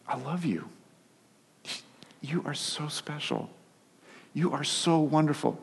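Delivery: soft, gentle reassurance; tender. Retold or imitated. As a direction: soothe the child quietly. Father calms child tenderly.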